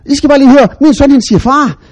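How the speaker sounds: loud, high, thrilled announcement; bursting with pride on the phone; proud excitement. Retold or imitated. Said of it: "Og så sagde jeg". (stolt far ringer rundt, begejstret)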